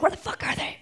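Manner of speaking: put-on hissed teen frustration, under the breath; annoyed whisper. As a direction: grumble it under your breath. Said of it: "was getting pissed 'cause I was like".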